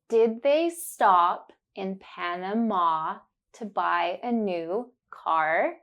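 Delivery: slow, deliberate, every ah clear; slow and careful. Shown as a demonstration. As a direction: spell it out slowly for the learner. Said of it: "say it slowly and focus on the sound".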